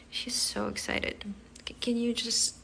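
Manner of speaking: soft unvoiced whisper aside. Real, not acted. Owner whispering an aside while the kitten explores. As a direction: breathe it out with no voice, like a secret.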